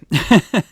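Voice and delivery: sudden loud high burst of laughter; a real laugh at his own dark joke. Real, not acted. Host laughing at the grim recap, a loud burst.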